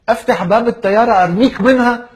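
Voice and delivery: loud, high-pitched fervour; mock impassioned shouting; hammed-up outburst. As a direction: burst out as an overwhelmed fan. Admirer's overflowing love, comic outburst.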